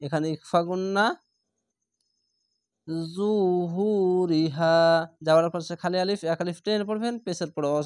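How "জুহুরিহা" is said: very slow, drawn-out chanted recitation; Quran recitation. Shown as a demonstration. Teacher modelling the recited word for learners, slow and chanted. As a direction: stretch every vowel like a reciter. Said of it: "recites the word as a model".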